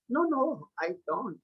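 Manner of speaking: "No, no, I don't" said softly, dropping away on "don't"; soft first take. Shown as a demonstration. Teacher demonstrating intonation to students, softly. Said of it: "first slowly and after that loudly".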